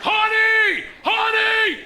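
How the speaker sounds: shrieked high across the store; piercing public holler; loud shout. Acted. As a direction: yell it to embarrass your wife. Yelling repeatedly at wife.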